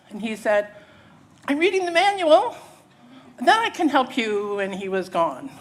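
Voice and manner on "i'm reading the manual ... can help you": high, loud call from a distance; shouting. Acted. Distant person answering the rafter, high shouted reply. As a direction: holler it back across the water. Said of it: "have a conversation shouting".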